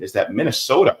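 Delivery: normal talking voice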